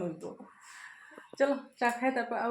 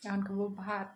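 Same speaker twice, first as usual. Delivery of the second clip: hushed, quiet voice; 9 dB quieter than usual. Real, not acted. Mother talks softly while her daughter sleeps, hushed. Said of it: "আস্তে কথা বলি".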